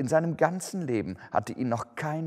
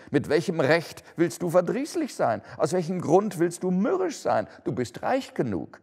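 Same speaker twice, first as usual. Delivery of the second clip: cheerful higher teasing retort; merry nephew teasing; 7 semitones higher, 3 dB louder than usual. A narrator's reading. Nephew mirroring his uncle's words, teasing and merry. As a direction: toss his words back playfully. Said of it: "erwiderte der Neffe lustig".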